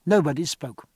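usual speaking voice

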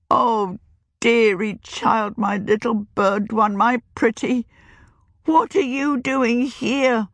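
put-on high, slow, pleading old crone; a cursed old woman begging the child away; trembling witch warning the girl to keep off; frightened old witch. A narrator's reading.

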